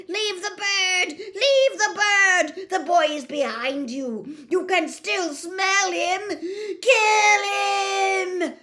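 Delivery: high, loud frantic commanding shrieks; screaming villain. A narrator's reading. Dark wizard screaming orders at the blind serpent. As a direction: bark orders at the snake, hysterical.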